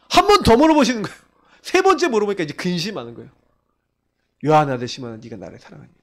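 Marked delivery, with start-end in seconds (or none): 4.4-5.9 low, near-whispered question fading out. Retold or imitated. Jesus asking Peter the third time, hushed and tender. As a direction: ask it a third time, gently.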